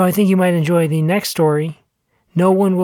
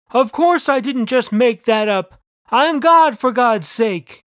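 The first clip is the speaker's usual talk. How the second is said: God, mild yell; 7 semitones higher, slower than usual. A narrator's reading. God answering the narrator, mild exasperated yell. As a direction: retort as God, punching the middle. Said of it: "yelled God".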